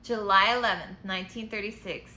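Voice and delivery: storybook letter read aloud; reading to children. A narrator's reading. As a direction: read it like a bedtime picture book.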